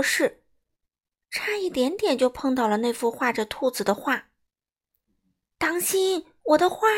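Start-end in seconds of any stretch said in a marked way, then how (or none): 5.6-6.9 high, urgent warning call; acted storybook shout of warning; crying out. A narrator's reading. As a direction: call out in alarm, pitched up. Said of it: "米歇尔叫道".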